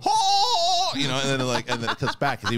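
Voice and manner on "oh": high, loud mock old-film yelp; old movie shriek. Old movie clip of a guy reacting, hammed-up shriek. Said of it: "an old movie of a guy going".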